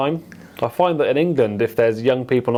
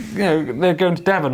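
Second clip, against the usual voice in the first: ordinary delivery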